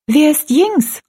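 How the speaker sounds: put-on curious girl, voice leaping high; high, animated question; lively. A narrator's reading. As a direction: ask brightly as an eager girl. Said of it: "wurde ganz lebhaft bei dem Gedanken".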